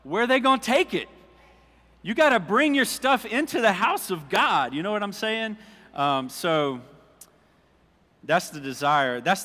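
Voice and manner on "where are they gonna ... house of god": emphatic retort. Retold or imitated. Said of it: "And I was like".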